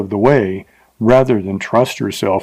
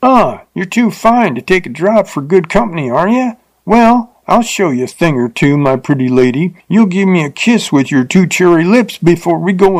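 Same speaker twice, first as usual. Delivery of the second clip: put-on higher, menacing drunken rider; drunken menace; 7 semitones higher than usual. A narrator's reading. Drunk rider menaces the teacher who refused, leering. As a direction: threaten her with leering force. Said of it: "he turned suddenly fierce".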